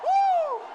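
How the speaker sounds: high, loud joyful whoop; ecstatic whoop. Real, not acted. Preacher to church, a loud whoop of joy. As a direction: let out a piercing cry of joy. Ecstatic cry after praise.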